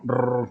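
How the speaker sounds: R trilled like an engine; motor-like rolled r. Shown as a demonstration. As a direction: rumble the R like a motor. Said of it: "como un motor". (R vibrante como un motor)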